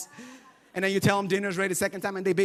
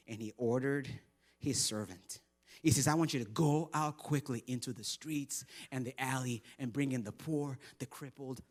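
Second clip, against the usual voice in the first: low, much softer, grave reading; solemn scripture reading; 10 semitones lower, 9 dB quieter than usual. Acted. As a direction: read it hushed and weighty.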